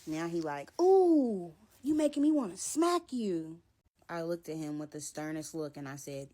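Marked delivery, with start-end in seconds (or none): 0.8-3.6 high, loud put-on menace; mock threat. Boyfriend threatening to smack her, high mock voice.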